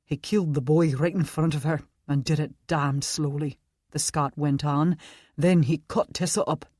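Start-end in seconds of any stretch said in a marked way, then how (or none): 0.1-3.6 put-on Scottish accent. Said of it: "Scottish accent". 5.4-6.7 put-on Scottish accent. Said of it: "Scottish accent".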